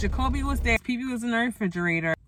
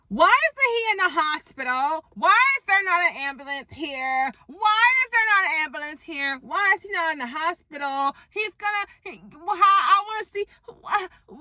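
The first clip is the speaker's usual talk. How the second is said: high, exasperated questions; outraged viewer asking why; upset viewer in disbelief at the scene; 7 semitones higher than usual. Real, not acted. Shocked at the show's ending.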